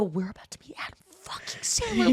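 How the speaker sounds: excited hushed whisper. Retold or imitated. Whispering to friends before meeting a star, hushed. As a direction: whisper it breathlessly. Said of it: "I go".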